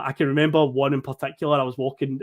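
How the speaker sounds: ordinary delivery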